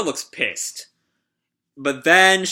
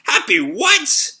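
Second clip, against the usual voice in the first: much higher, slow, stunned question; high stretched-out what of total shock; jaw-dropping shock; 13 semitones higher, 5 dB louder, slower than usual. Acted. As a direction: gasp it out in disbelief. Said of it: "Shiki's jaw just drops".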